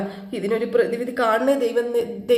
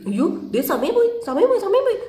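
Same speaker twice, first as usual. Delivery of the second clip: flustered; 6 semitones higher than usual. Retold or imitated. Sudhi flustered, dodging his wife's questions, in a panicky rush. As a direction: babble in a hurry to get out the door. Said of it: "എന്ന് പറഞ്ഞുകൊണ്ട് വെപ്രാളപ്പെട്ട്".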